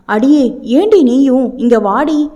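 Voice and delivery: ordinary delivery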